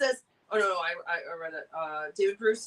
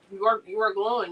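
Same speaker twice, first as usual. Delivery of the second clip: ordinary talk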